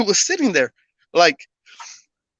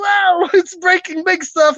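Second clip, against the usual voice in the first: high, drawn-out imitation of alarmed friends; mock alarm; 8 semitones higher than usual. Friends react to trees breaking.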